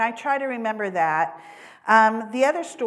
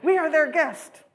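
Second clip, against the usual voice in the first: loud, higher mock scolding from the pastor; exaggerated scolding; 8 semitones higher, 7 dB louder than usual. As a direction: bark it like a stern pastor, hammed up. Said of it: "he didn't say it quite like that. I'm exaggerating".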